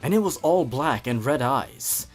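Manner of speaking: ordinary delivery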